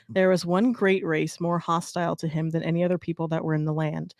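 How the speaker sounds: reading aloud. Acted. Friend reading the Saint Patrick legend, storybook style. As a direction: read the old legend with mock solemnity.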